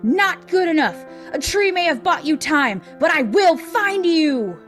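high, slow, drawn-out vow; villain's threatening call. A narrator's reading. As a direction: call menacingly after fleeing prey. Villain calls after fleeing friends.

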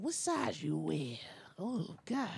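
slow, whispered mock arousal; hushed imitation of a man getting aroused; imagined suitor's voice. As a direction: whisper it like a creepy, turned-on suitor. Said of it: "Did he talk like that?".

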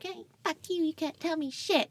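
high, squeaky mock defiance; mouthing off. Small drunk self mouthing off at huge friend, mock defiant. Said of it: "while I'm mouthing off".

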